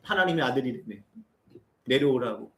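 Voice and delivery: normal talking voice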